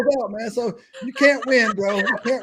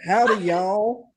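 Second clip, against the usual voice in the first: slow Southern drawl with W's added; exaggerated Southern twang, vowels stretched; Southern accent; much slower than usual. Shown as a demonstration. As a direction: demonstrate a drawn-out Southern accent. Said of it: "then add W's everywhere".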